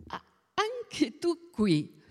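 slow, high-pitched note of surprise; surprised greeting. A narrator's reading. Anna Carla runs into her friend Bona in the shop, surprised.

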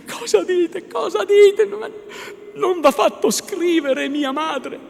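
high, loud female protest; frantic high-pitched questioning; distressed young woman. A narrator's reading. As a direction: plead in rising alarm. Lucia distressed by Renzo's reproach.

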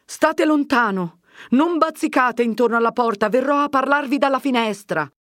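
high, loud warning; shouting from inside. A narrator's reading. Agnese warns the visitor off her door, calling out. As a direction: call out to keep the visitor back. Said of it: "gridò da dentro la voce d'Agnese".

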